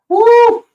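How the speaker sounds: high, loud whoop of the jump. Retold or imitated. Friend recalling the dive, whooping loud and high. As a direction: let out a big leaping whoop. Jumping off the diving board.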